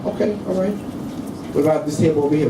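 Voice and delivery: ordinary talk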